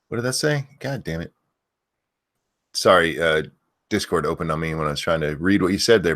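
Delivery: ordinary delivery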